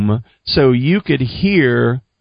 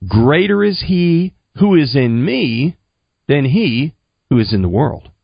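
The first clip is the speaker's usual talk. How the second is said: deliberate recitation. Retold or imitated. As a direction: measure it out phrase by phrase. Teaching a verse to repeat.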